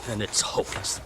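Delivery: hopeless whisper. Acted. Despairing youth after bad news.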